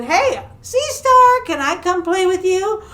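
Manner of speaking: high, drawn-out hopeful call; eager calling out. Acted. Lonely shark asks Sea Star to play, eager call. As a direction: call to a would-be playmate.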